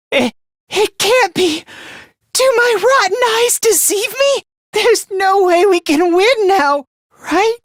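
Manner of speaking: high-pitched, stammering dismay; panicked disbelief. Acted. Realizing the battle can't be won, panicked disbelief. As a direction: start stunned, then spill out in high despair.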